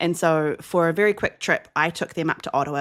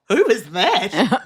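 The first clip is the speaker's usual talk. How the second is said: mum's surprised reaction; 3 semitones higher, 5 dB louder, much slower than usual. Retold or imitated. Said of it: "she's like".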